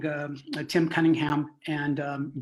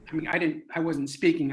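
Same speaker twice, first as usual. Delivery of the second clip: plain reading voice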